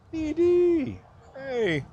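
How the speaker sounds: loud high call across a crowd. Real, not acted. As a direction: shout to catch a friend's attention. Spots friend, calls out to him.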